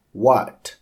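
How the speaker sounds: usual speaking voice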